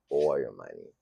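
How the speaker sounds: a low murmured echo of the slogan. Shown as a demonstration. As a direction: let Or your money fall away low. Repeats the phrase.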